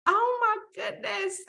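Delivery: playing up how startled she was; retold gasp of surprise. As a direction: pitch jumps way up in mock alarm. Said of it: "And I went".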